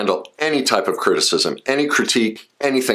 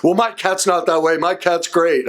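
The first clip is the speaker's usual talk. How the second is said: high-pitched mock protest, much higher voice; indignant cat owner; 11 semitones higher than usual. Cat people protesting their cat, mock high voice. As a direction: squeak it like an offended cat lover. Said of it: "I'm gonna get cat people going".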